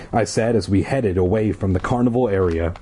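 usual speaking voice

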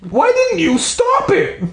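slow, high screaming demand; dad keeps screaming at Brandy, slow and high; dad still screaming. Acted. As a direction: drag out the furious scream.

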